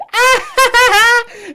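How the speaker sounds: shrieking burst of delighted laughter; loud high victory laugh; high gleeful laugh. Real, not acted. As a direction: cackle in triumph. Teammate got banned.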